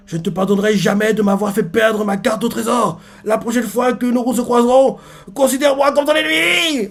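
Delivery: high indignant rant climbing to the end; acted cartoonish fury; vengeful declaration. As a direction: declare a feud, pitch building. Baggy storms off declaring enmity.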